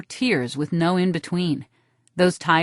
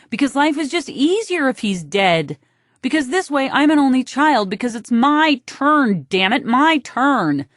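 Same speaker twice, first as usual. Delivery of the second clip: louder and higher, rising frustration; resentful outburst; 7 semitones higher, 4 dB louder than usual. A narrator's reading. Blurting resentment about her brother.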